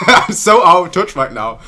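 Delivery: loud, high laughing self-mockery. Real, not acted. Streamer thought today was Tuesday, laughing at himself. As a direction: laugh loudly at your own mistake, pitch up.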